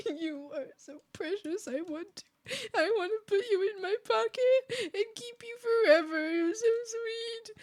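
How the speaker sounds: high, tiny cooing voice; adoring gushing. Retold or imitated. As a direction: coo as if overcome by cuteness. Gushing over adorable customers.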